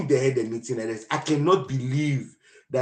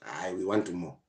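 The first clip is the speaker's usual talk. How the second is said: mock crowd; 9 semitones lower, 12 dB quieter than usual. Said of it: "people eventually were like".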